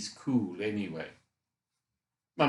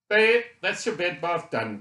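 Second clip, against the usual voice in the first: higher, louder voice for a nurse; nurse's cheery call; 7 semitones higher, 7 dB louder than usual. A narrator's reading. Nurse Tracy tells bedridden Robbie the bed bath is done, bright call. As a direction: call it out to the patient, pitched up.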